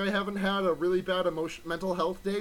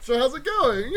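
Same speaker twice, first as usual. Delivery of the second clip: host intro voice; 7 semitones higher, 7 dB louder than usual. Acted. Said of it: "Take two".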